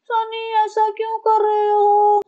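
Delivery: high, slow, coy whine; mock delicate wife. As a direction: play the fragile little flower. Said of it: "छुई मुई नाजुक कली बनी है ये". (ऊँची धीमी नाज़ुक आवाज़)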